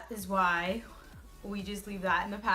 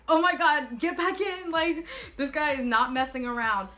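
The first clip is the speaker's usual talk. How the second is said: high, loud startled panic; freaking out in a rush; panicked; 6 semitones higher, 5 dB louder than usual. Retold or imitated. Panicked retelling of the stop.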